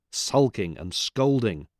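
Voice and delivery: ordinary delivery, nothing marked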